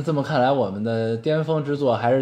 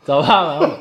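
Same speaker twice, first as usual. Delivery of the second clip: laughing and louder; 4 semitones higher, 8 dB louder than usual. Real, not acted. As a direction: laugh through the correction. After misreading, laughs.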